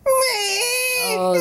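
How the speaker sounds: sudden high yelp of pain; high cry. Acted. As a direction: yelp as if bitten. Attacked by the baby.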